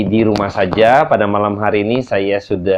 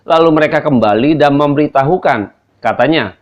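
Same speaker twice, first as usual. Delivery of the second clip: raised, measured Bible reading; scripture reading; 6 semitones higher than usual. Acted. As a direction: read the passage out with weight. Reads scripture aloud.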